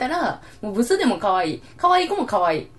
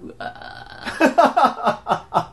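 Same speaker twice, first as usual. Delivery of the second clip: disgusted groan; 3 semitones higher, much slower than usual. Real, not acted. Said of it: "なんか気持ち悪いわ".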